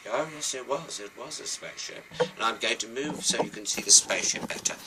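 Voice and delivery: ordinary delivery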